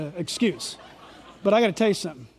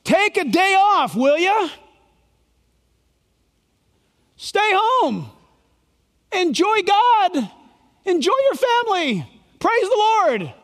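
higher, inviting paraphrase of God; God's invitation; 8 semitones higher than usual. Retold or imitated. Preacher voicing God inviting people to rest, warm. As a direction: coax them kindly to rest. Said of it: "God says to us".

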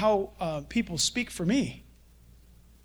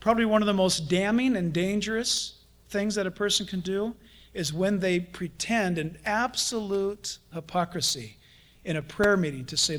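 ordinary talk

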